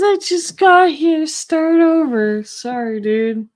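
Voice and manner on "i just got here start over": high, louder squeaky ghost voice; voicing the ghost. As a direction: play the ghost as a cheeky high-pitched complainer. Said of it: "Spooky says".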